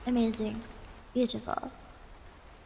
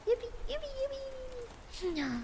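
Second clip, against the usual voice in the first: high, soft, squeaky yippee; squeaky cheer; 10 semitones higher, 11 dB quieter than usual. Pretend. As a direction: cheer like a tiny cartoon.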